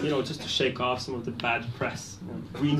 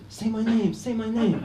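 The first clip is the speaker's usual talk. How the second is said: mock passionate plea; 9 semitones higher than usual. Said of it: "and then you blow out".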